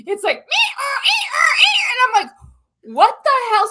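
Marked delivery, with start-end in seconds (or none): 0.4-1.8 high, loud wailing car alarm sound; car alarm imitation. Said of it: "I hear Justin's car alarm going off". 3.0-3.7 alarmed outcry. Retold or imitated. Woken by alarm, alarmed outburst.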